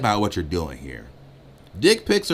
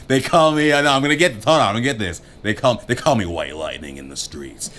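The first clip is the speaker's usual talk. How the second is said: hammed-up pickup line; 3 semitones higher, 6 dB louder than usual. Shown as a demonstration. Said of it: "you could be like".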